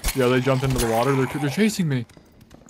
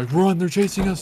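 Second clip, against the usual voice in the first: being chased; 6 semitones higher than usual. Real, not acted. Fleeing enemies in game.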